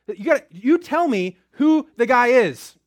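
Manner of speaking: demanding king. Acted. King pressing the room to name the guilty man, loud. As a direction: push hard for the name.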